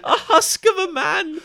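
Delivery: high, loud, drawn-out cry through laughter; real laughter breaking into a slow squeal; laughing exasperation. Real, not acted. Podcaster mocking the dull video host, helpless laughing exasperation.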